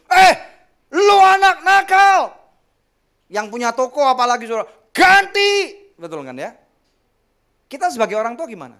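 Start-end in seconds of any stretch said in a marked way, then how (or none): 0.1-2.3 put-on high, loud, slow yell at a naughty kid; angry scolding shout. Security guard yelling at a child who broke the shop window, furious shout. 4.9-5.8 high, loud one-word bark; angry demand. Acted. Shop owner shouting at the parent to pay for the glass, angry yell.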